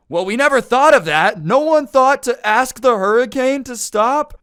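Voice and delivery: loud, high-pitched sarcastic disbelief; mock astonishment. Roommates mocking the idea to stop hurricanes, hammed-up astonishment.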